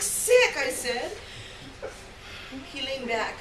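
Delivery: ordinary delivery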